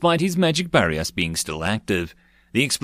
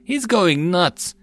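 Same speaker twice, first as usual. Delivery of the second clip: high, stretched-out cry of alarm; alarmed, high-pitched exclamation; panicked cry; 9 semitones higher, much slower than usual. A narrator's reading. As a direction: call it out in panic. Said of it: "cried out in panic".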